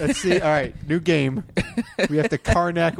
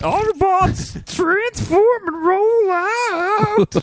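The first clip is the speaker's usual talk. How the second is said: very high, slow, booming robot command; rally the troops like a robot leader; Optimus Prime impression; 3 dB louder, much slower than usual. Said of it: "Zero farting in [name]'s impression".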